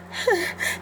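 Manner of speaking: high startled squeal; panicked yelp. Acted. Wolf scared by creature, high frightened yelp.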